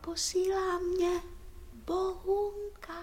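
very quiet. Acted. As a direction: pass it on softly, as a secret singsong. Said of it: "Neumí nahlas, je tichá". (tichý, zpěvavý vzkaz)

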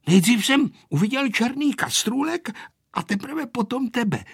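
put-on high, mildly teasing boy's reply; grimacing, taunting. A narrator's reading. Vojta answering the angry girl, mildly taunting. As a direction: answer with a touch of mockery. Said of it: "odpovídal Vojta a šklebil se".

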